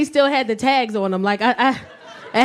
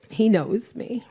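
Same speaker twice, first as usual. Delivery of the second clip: ordinary talk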